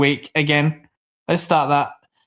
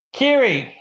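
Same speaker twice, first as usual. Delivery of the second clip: a high call of a mate's name; 6 semitones higher than usual. Real, not acted. Streamer calling out to his absent co-host, high call. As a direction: call out to get his attention.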